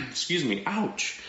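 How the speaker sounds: usual speaking voice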